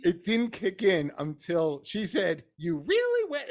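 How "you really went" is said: a lightly done Southern mama, rising in disbelief; imitating a Southern mom asking in disbelief. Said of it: "she did her best Southern mama voice".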